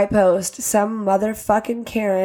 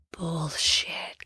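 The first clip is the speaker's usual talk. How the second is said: whispered emphasis; 4 semitones lower, 10 dB quieter than usual. Real, not acted. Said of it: "emphatic aside".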